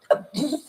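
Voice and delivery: short laugh at own slip; embarrassed giggle. Real, not acted. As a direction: giggle at the fumble. Host laughs at her slip.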